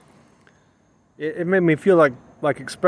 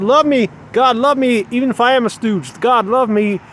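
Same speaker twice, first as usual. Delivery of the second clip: higher, louder begging to God; pleading prayer; 3 semitones higher, 4 dB louder than usual. Acted. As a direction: cry out to God for love, pleading. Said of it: "Am I saying".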